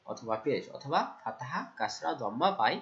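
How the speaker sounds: ordinary delivery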